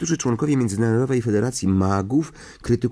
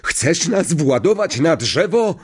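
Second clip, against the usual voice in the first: high, raised character outburst; roaring; 9 semitones higher, 4 dB louder than usual. A narrator's reading. Stan scolds after the bus swerves, roaring. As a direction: yell at him in alarmed outrage. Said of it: "ryknął Stan".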